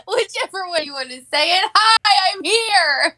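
giggling through the greeting; laughing. Real, not acted. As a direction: laugh between words. Jokey name greeting, laughing.

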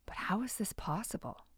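breathy hushed wonder; whispering. A narrator's reading. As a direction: whisper the question to yourself.

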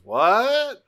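high, loud hammed-up what; mock shock. Longarm hiding his cannon from Bumblebee, hammed-up shocked what.

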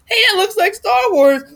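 put-on young kid voice. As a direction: sound like an eager little kid. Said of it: "so I was like".

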